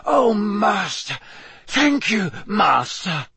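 high, overwrought servile thanks; tearful gratitude. Acted. Wounded servant thanks his master, high and overcome. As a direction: gush thanks in a high, desperate voice.

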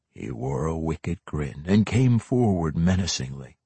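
usual speaking voice

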